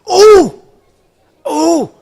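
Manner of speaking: sudden high, loud delighted cries; excited yelp. Real, not acted. Streamer spotting the rarest mineral drop, loud excited cries. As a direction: burst out with excitement at a rare find. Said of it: "Sorry, I'm very excited".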